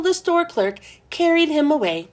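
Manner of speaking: ordinary delivery, nothing marked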